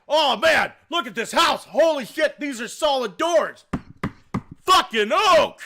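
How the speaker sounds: high, loud, overexcited gushing; guy loudly marvelling at oak doors; excited gushing. Retold or imitated. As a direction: rave like an overeager inspector. Inspector gushing over house.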